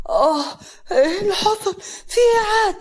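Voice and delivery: high, loud shocked cries; panicked outburst, shooting up in pitch; startled. A narrator's reading. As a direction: yelp in alarm as if jolted awake. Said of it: "محمد بخضة".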